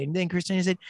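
ordinary talk, nothing marked

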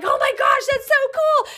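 high-pitched thrilled exclamation; excited gushing. Retold or imitated. Telling viewers her reaction to the lucky plate, excited gushing. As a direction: gush it in a high, amazed voice.

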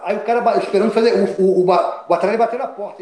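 tumbling, loud storytelling burst; excited loud telling. Real, not acted. Telling the interviewer about the knock at the hotel door, loud and excited.

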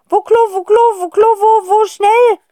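high, loud, hurried alien voice; frantic squeaky plea for the loo; gasping alien. Acted. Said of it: "japst es dabei". (hohe, laute, gehetzte Alienstimme)

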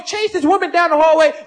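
normal talking voice

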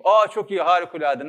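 loud, high burst of delight; oblivious, over-loud enthusiasm; delighted exclamation. Acted. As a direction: gush loudly over wonderful news. Deaf visitor's rehearsed cheerful reply.

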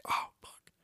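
breathless hushed curse; panicked whisper. Retold or imitated. Brothers see holes in the wall, whispered curse. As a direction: whisper it, caught red-handed. Caught after shooting holes.

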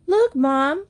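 high, excited call; delighted cry. A narrator's reading. Betsy calls Mom to watch Wag brushing, delighted cry. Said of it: "cried Betsy".

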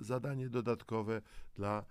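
ordinary talk, nothing marked

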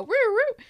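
high, loud "woo, woo" of a toy police car; whooping mock siren; imitated toy siren. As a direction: mimic a little siren going off.

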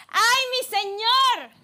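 high, slow cry of alarm; a frightened servant crying out to his master; panicked exclamation. Acted. The servant waking to an army, crying out in panic. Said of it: "exclamó".

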